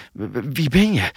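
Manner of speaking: stuttering, frightened question; halting stammered question; startled stammer. A narrator's reading. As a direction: stumble over the first word in shock. Startled captive stammers at rescuer.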